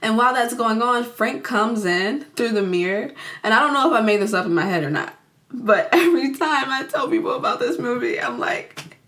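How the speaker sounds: ordinary delivery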